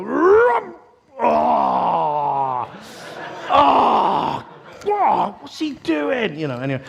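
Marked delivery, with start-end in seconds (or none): 0.0-5.4 loud, high-pitched fan's outburst; mock goal roar. Three away fans reacting to Lampard's goal, acted roaring. 5.4-6.4 mock fan protest. Fans in hostile end after goal.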